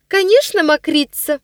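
put-on girl's echo. A narrator's reading. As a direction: chime in like a spiteful little girl. Ниночка echoes her brother. (высоко, звонко, поддакивая)

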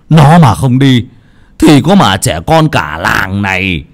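high, loud tirade; furious scolding that rises to a drawn-out cry; angry cursing. Acted. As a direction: berate them, drawing out the phrase ends. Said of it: "chỉ luôn mấy người mà chửi".

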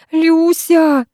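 high scream of her name. A narrator's reading. Vera hangs over the abyss calling Lyusya, screaming. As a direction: cry out for help. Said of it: "снова закричала я".

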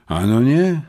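disconcerted, pitch climbing to the end; abashed admission. A narrator's reading. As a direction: admit it, thrown off balance. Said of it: "przyznałem stropiony".

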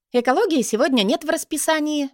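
bright, excited and high; happy announcement. A narrator's reading. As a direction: announce the good news gleefully. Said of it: "радостно сообщила".

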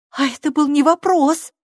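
stern. A narrator's reading. Girl copies her mother to the stranger, stern. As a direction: scold like a strict mother. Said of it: "строго ответила Сьюзи, подражая своей матери".

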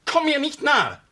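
high, loud furious shout; shrieking command to keep away; shouted repeat. Acted. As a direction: repeat the warning as a scream. Vicar rebuffs Charlotte.

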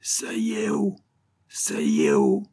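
strange parrot squawk; eerie inhuman bird-like call; shrill, grating cry. A narrator's reading. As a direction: screech it like a parrot, voice distorted. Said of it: "aiguë, crissante, cria".

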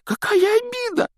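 high gasping elf question; breathless gasp. A narrator's reading. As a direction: gasp it out, stunned. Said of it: "задохнулся эльф".

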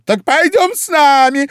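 very high, loud royal summons; shriek. A narrator's reading. Queen summons Alice to the procession, shrieking. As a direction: squeal the invitation as an order. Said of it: "взвизгнула королева".

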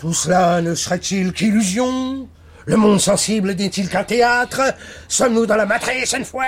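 put-on high, theatrical Belgian accent; mock Belgian accent. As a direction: ham up a Belgian accent, voice rising with each question. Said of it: "Arrêtez de prendre cet accent". (accent belge caricatural)

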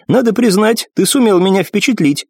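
a proud war goddess speaking; commanding warrior goddess. Acted. Nephrite voiced, higher.

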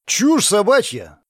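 high, drawn-out indignant exclamation; explosive outburst. A narrator's reading. Hero to Luka about the empty kitty, exploding. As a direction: blow up in disbelief. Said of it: "Взорвался я".

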